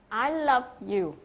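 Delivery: slow "I love you" with "love" punched and falling; "love" stressed, "I" and "you" light. Shown as a demonstration. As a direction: lean hard on the verb "love". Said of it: "phải nhấn vào động từ love".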